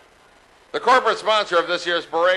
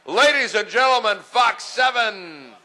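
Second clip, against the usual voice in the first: announcer call; 6 semitones higher than usual. Real, not acted. Announcing the next entry.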